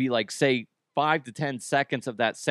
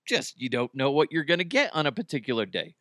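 usual speaking voice

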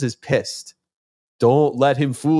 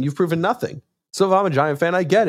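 excited emphasis; 6 semitones higher than usual. Real, not acted. Fan rant, rhetorical.

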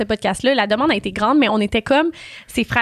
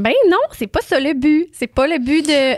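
indignant retort; 5 semitones higher, 3 dB louder, slower than usual. Retold or imitated. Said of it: "J'étais comme".

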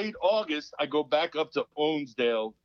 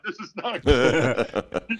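laughing dread; 2 semitones lower, 5 dB louder, much slower than usual. Retold or imitated.

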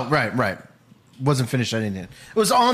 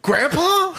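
put-on very high, questioning child voice; mock child; 13 semitones higher than usual. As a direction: squeak it like a puzzled kid. Mock child answering the hero.

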